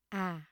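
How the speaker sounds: "à" sliding gently downward; huyền falling tone. Shown as a demonstration. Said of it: "dấu huyền".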